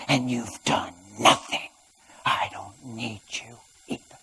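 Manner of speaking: bitter fading whisper. Retold or imitated. Wife's prayer fades.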